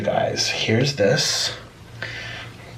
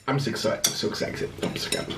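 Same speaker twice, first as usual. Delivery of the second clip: fading whisper; 11 dB quieter than usual. Real, not acted.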